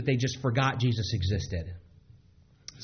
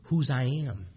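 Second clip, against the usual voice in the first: tender reminder. Retold or imitated. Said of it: "Jesus is looking here".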